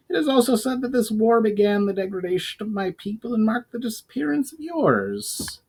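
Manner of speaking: goblin voice. Acted. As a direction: lift the voice for the goblin's solemn lore.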